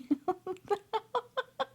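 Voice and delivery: high squeaky giggle; a delighted little squeal of a laugh. Real, not acted. Giggling at cute toy.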